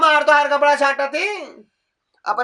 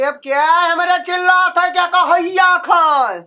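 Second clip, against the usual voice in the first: angry shout; 3 semitones higher, 3 dB louder than usual. Acted. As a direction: shout it louder and higher, scolding. Old woman scolds the household.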